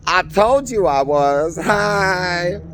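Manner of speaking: breezy I-told-you-so return; Jesus returning. Retold or imitated. Jesus back on earth greeting everyone cheerily. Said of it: "like Jesus came back and went".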